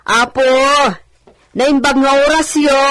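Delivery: loud, high call toward the house. Acted. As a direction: hail whoever's inside. Calling out greeting at door.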